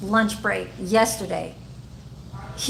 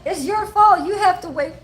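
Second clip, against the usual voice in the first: bossy sister; 8 semitones higher than usual. Retold or imitated. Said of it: "She'd be like".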